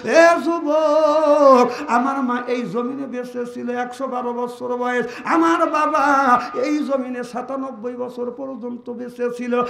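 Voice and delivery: sung, surging sermon chant; preaching at full force. Acted. Preacher telling Fatema's death to the gathering, chanting. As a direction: chant the story to the crowd. Preaching about honoring parents.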